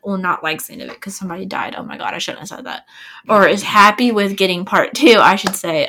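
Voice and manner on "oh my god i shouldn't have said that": quick whispered aside, low and hurried; host catching her own spoiler in a rushed whisper; embarrassed whisper. Real, not acted. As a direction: mutter it fast under your breath after a slip. Realizes she spoiled the story.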